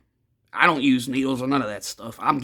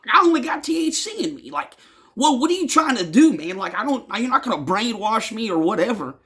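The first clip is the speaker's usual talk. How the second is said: higher-pitched insistent protest; adamant; 7 semitones higher than usual. Retold or imitated. Insisting to a pushy stranger that he's clean, adamant. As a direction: retell it adamantly, pitch jumping up on the denials.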